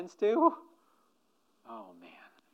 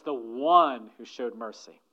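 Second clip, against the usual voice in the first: grudging, drawn out; 3 semitones lower, 7 dB louder than usual. Retold or imitated. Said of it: "He says".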